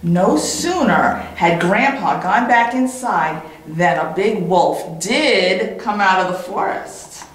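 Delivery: ordinary delivery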